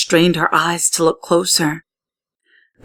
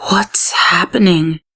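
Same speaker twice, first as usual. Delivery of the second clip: slow, hushed, scared whisper; frightened whisper. Acted. Amaya watching the shapes, slow frightened whisper. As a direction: breathe it out in fear.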